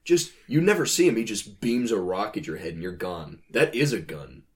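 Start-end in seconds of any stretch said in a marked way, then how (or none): none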